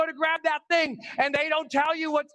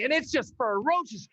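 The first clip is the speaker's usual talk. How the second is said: ordinary delivery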